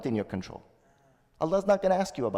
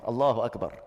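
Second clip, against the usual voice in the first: usual speaking voice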